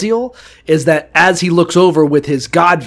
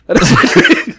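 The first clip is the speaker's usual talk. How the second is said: dream breaks into a giggle, higher and airy; laughing through it; 5 semitones higher, 3 dB louder, faster than usual. Retold or imitated. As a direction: laugh while saying the dream. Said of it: "he's like, he's like".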